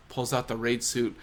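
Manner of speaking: normal talking voice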